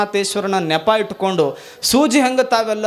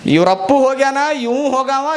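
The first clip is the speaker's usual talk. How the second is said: mocking bond paper; 5 semitones higher than usual. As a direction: crow it like a paper that outlives its owners. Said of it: "ಅಂತದು bond paper".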